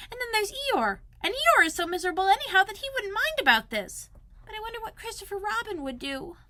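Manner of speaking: high little Piglet voice, fretting; a small worried animal talking to himself, pitched high. Acted. Piglet alone in the rain, wishing for friends, high fretful voice.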